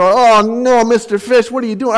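put-on high-pitched kids' dismayed cry; kids protesting. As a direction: imitate children calling out in dismay. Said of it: "the kids go".

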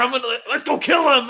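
high, loud charging yell; hot-headed war cry. Retold or imitated. Podcaster imitating reckless soldiers rushing to attack, high loud yell.

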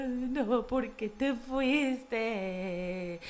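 hammed-up soap-opera wail, very slow; mock telenovela crying. After her first breakup, mock soap-opera weeping. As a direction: drag it out like a mock soap heroine. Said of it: "y actué como una telenovela".

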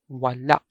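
"wala" with a short last A cut off by a glottal catch; final glottal stop. Shown as a demonstration. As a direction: chop the end of "wala" in the throat. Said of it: "short vowel with a stop".

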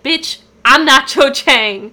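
acted outrage at full voice; indignant retort. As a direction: snap back, offended, drawing the words out. Acted angry retort in retold scene.

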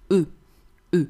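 E as a short French rounded "euh"; French E sound. Shown as a demonstration. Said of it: "In French, the letter E is pronounced".

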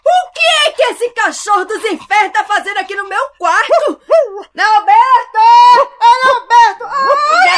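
high, loud, tearful yelling; crying out in distress. Acted. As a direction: wail and shout for her son. Mother crying out at dog.

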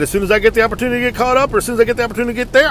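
fast, hurried reassurance; rushed promise. Retold or imitated. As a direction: rattle it off quickly.

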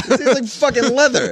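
high-pitched comic disbelief; mock outrage. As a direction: exclaim it high, appalled by the description. Mock outrage at wine description.

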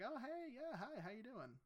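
high-pitched, softly hesitant small talk; awkward greeting. Acted. Greeting a cousin not seen for years, acted awkwardness. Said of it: "they have that awkward conversation".

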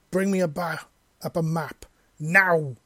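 urgent shouted order, loud on now; yelling. A narrator's reading. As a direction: bark the order, punching the last word. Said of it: "she yelled".

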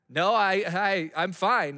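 mock winded, halting denial; out-of-breath denial. Son covering up to parents after sprinting, mock out-of-breath denial. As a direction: stammer it out slowly, feigning being fine. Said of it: "Why are you so out of breath?".

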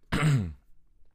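a loud real clearing of the throat; sharp throat clear mid-sentence. Real, not acted. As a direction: clear your throat hard, then apologize. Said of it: "excuse me".

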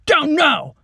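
loud, high barked command; shouted order. Acted. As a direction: bark it at her over the gun. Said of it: "shouted at Lacey".

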